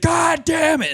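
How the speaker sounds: high-pitched mock scream; imitated screaming. Imitating the man screaming back at his apology, high scream. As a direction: cut in with the old man's outraged scream. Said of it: "he was just screaming".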